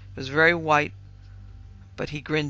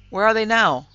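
loud, higher question; sharp demand; 6 semitones higher, 9 dB louder than usual. A narrator's reading. Father questioning his frightened daughter, sharp demand. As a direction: fire the question out urgently.

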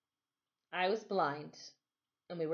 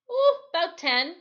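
higher, louder easy answer; Timothy voiced; 11 semitones higher, 9 dB louder than usual. Timothy answers the boy, higher and louder. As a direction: toss it off casually.

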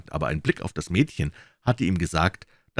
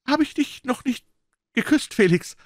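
put-on frightened girl, much higher voice, halting; stammering. A narrator's reading. Frightened fiancée stammering to the doctor, much higher voice. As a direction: stammer it out, nervous and pale. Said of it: "stammelte sie".

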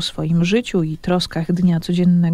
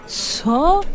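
indignant; 9 semitones higher than usual. A narrator's reading. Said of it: "obruszyli się rybacy".

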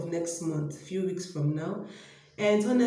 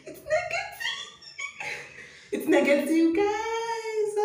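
very high, thrilled squeal; overjoyed relief. Real, not acted. Woman telling viewers her test is negative, overjoyed. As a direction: squeal it out, building to a high sing-out. Sees the negative pregnancy result.

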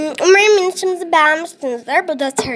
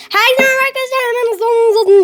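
high, rushed greeting to viewers; excited vlog hello; 6 semitones higher, 4 dB louder, much faster than usual. Real, not acted. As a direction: hurry the hello, all pumped up. (izleyicilere heyecanlı, aceleci selam)

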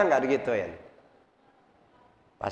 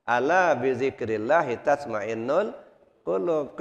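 ordinary talk, nothing marked

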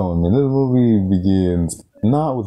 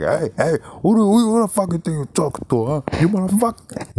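Tony Montana; 11 semitones higher than usual. Retold or imitated. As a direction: hurl it down the phone like a gangster. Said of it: "he just goes like".

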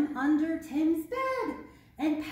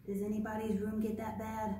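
usual speaking voice

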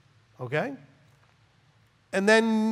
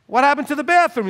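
loud, high demand about the bathroom; demanding boss; 11 semitones higher, 9 dB louder than usual. Retold or imitated. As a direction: call out the missing job loudly. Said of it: "So when I come in and go".

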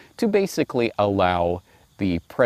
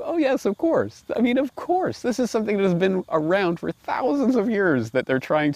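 much higher, exaggerated dawning realization; mock epiphany; 12 semitones higher than usual. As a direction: play up the obvious aha moment. Voicing his own realization.

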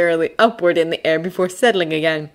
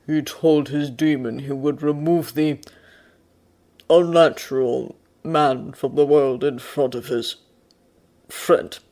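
slow bear. A narrator's reading. Bear answers the man, censoring his language, slowly. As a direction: speak slowly, tasting the words before saying them. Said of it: "answered the bear slowly".

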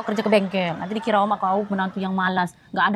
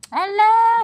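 alah kesal; 11 semitones higher, 5 dB louder than usual. Acted. Istri kesal membantah suami.

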